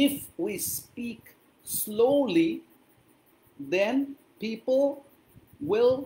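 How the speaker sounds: word by word with long pauses; slow, clear English. Shown as a demonstration. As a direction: speak slowly so every learner can follow. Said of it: "একটুখানি slowly বলতে হবে". (ধীরে ধীরে, থেমে থেমে ইংরেজি)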